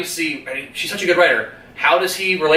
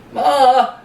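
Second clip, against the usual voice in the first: high, louder cry of Ma; calling out; 11 semitones higher, 4 dB louder than usual. Retold or imitated. Credence crying out to his abusive mother, high loud call. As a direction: call to your mother in pain.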